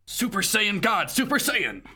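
high, hammed-up transformation cry; mock anime power-up. Joking about Terra's dark transformation, mock anime cry. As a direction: call it out high like an anime hero.

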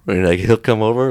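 ordinary talk, nothing marked

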